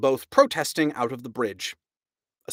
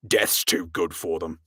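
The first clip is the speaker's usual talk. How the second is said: grim captain; 7 semitones lower than usual. A narrator's reading. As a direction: lower grim verdict.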